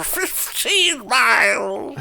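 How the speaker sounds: put-on old grandpa bragging slowly; Pop from Rugrats. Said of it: "my old man voice is just defaulting to, uh, Pop from Rugrats".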